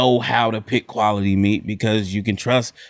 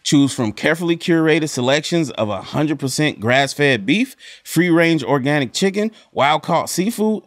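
upbeat sponsor read; lively ad read voice; 3 semitones higher than usual. Acted. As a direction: sell it like a podcast ad. ButcherBox ad read.

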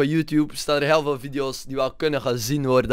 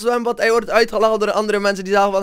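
usual speaking voice

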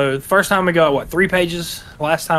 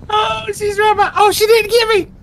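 panicked; 5 dB louder than usual. Real, not acted. Chased by lunch lady, panicking.

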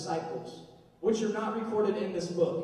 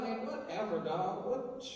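softer, dismissive shrug; 3 semitones lower, 7 dB quieter, slower than usual. Retold or imitated. Everybody brushing off the news, softer dismissive shrug. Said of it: "everybody's like".

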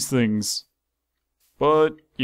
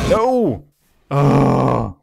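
frustrated cry; 7 dB louder than usual. Real, not acted. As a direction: blurt it as it all goes wrong. Dinosaur breaks out mid-sentence.

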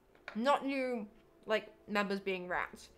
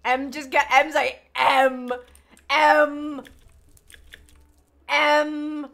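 exasperated; 2 semitones higher, 12 dB louder, much slower than usual. Real, not acted. Exasperated repeating letter M.

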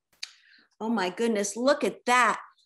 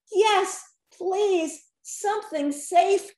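higher pleading; begging; 7 semitones higher, 3 dB louder, slower than usual. A narrator's reading. Genie begging for a safer wish, pleading. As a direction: plead for something safe. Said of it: "begged Rocky".

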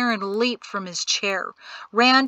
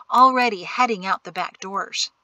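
usual speaking voice

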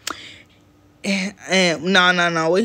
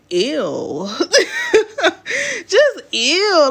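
disgusted; 9 semitones higher, much slower than usual. Real, not acted. Disgusted at his message.

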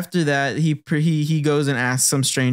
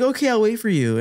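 put-on much higher, sweet childlike voice; little girl promise; 9 semitones higher than usual. Retelling the girl's promise to the boy, higher childlike voice. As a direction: play the young girl answering the boy.

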